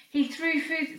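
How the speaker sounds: usual speaking voice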